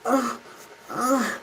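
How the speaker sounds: imitating a high strained groan; a high whiny mock grunt. Republicans mocked with a high strained groan. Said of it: "they go".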